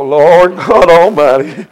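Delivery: a man crying out to the Lord for help; pleading prayer. Retold or imitated. Retold desperate prayer to God.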